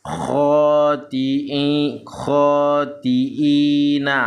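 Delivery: slow, held syllables in "Khoti'i, khoti'ina"; hamzah versus 'ain demo. Shown as a demonstration. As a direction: chant "khoti'ina" long, clean "i" at the break. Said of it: "antara I dengan I".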